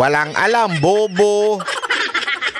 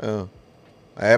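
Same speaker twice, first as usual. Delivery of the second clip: ordinary talk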